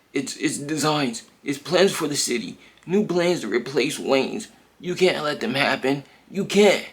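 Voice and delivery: high, rising panicked plea; panicked pleading. Retold or imitated. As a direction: beg her in panic.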